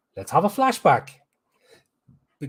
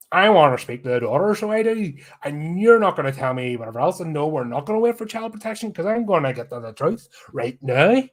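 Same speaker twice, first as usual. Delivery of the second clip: blunt Belfast detective; 4 semitones higher than usual. Retold or imitated. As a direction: ham up an impatient Northern Irish detective. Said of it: "us Belfast blokes ... short, blunt, and angry".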